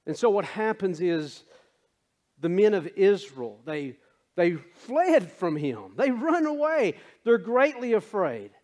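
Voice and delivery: emphatic sermon delivery; preaching. Acted. Preaches the men's flight.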